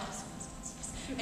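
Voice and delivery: breathless eager whisper, voice dropped right away; whispered yes chant, eager; excited whisper. Retold or imitated. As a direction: hiss it like she's finally coming. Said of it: "and I'm like".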